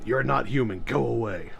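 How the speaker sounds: low, cold brush-off; dismissive human voice. Acted. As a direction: wave them off with contempt. Acting racist human rejecting alien.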